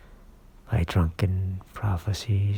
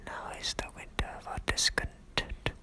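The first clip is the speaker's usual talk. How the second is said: quick, soft whisper; hushed soliloquy; 9 dB quieter, faster than usual. Acted. Richard alone, opening his soliloquy, in a quick whisper. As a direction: whisper the line through briskly.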